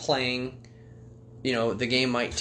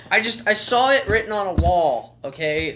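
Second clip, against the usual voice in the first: ordinary talk, nothing marked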